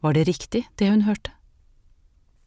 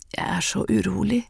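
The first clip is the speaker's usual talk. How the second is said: breathy, unvoiced whisper; frail whispered confession; anxious whisper; 3 semitones higher than usual. A narrator's reading. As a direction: whisper it like a sick, uneasy woman. Sick Andrea confides her unease.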